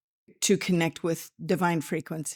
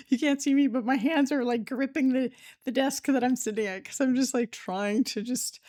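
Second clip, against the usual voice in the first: higher, giggly confession; laughing admission; 7 semitones higher than usual. Real, not acted. Podcaster tells listeners she is gripping the desk, laughing. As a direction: talk through a real laugh.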